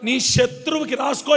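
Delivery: normal talking voice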